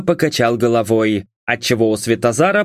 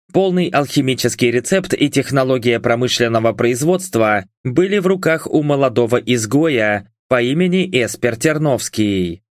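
plain reading voice

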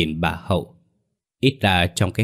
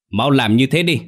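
higher, louder commanding shout; barked order; 6 semitones higher, 6 dB louder than usual. A narrator's reading. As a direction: bark the order at the men. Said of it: "quát lớn ra lệnh". (ông Cương quát to ra lệnh cho đám người)

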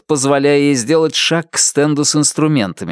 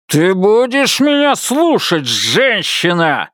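higher, slow and cruel; menacing threat; 7 semitones higher, slower than usual. A narrator's reading. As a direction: drag out the threat. Buster threatening.

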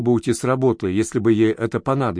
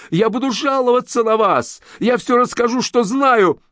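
much higher shrill threat; shrieking yell; 11 semitones higher than usual. A narrator's reading. Girl shrieking threats at her boss. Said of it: "заорала вдруг Галя".

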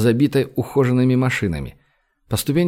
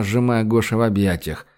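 usual speaking voice

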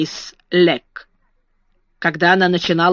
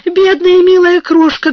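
high, loud pitying coo; agitated tenderness; 11 semitones higher, 6 dB louder than usual. A narrator's reading. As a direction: fuss over a wailing child in a raised, pitched-up voice. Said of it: "то грозно, то нежно".

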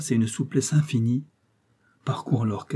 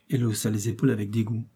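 ordinary delivery

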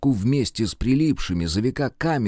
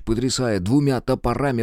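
usual speaking voice

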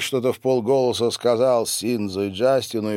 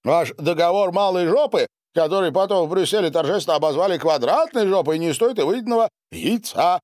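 plain reading voice

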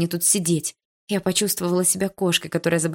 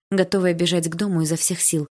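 ordinary delivery, nothing marked

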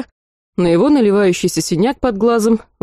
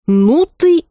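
sharp, higher indignant cry; indignant outburst; 8 semitones higher, 4 dB louder than usual. Acted. Redhead snaps at the cheeky girl, indignant and loud. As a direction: snap back as if insulted.

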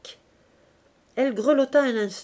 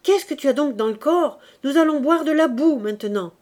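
higher and louder reproach; scolding; 5 semitones higher, 7 dB louder than usual. Acted. Gervaise scolds Augustine over the ruined coffee. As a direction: keep scolding the clumsy girl. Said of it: "cria Gervaise".

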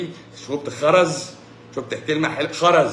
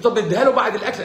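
laughing mock question; 6 semitones higher, 4 dB louder, faster than usual. Retells the woman asking again.

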